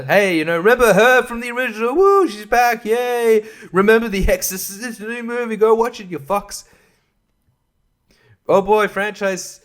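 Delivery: high, loud sarcastic cheerleading; mock hype. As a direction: sell it with over-the-top fake excitement. Sarcastic sales pitch for the sequel.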